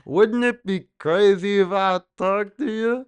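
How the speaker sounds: high sing-song mock Seinfeld voice; Indian Jerry Seinfeld. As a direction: ham up a Seinfeld pickup line in a mock Indian accent. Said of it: "Is that your Jerry Seinfeld impression?".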